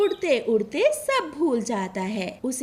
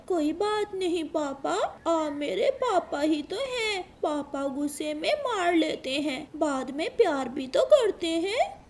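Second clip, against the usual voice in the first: put-on tender child voice; 5 semitones higher than usual. Sleeping son talks tenderly.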